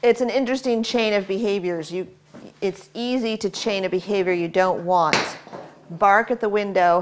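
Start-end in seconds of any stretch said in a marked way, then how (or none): none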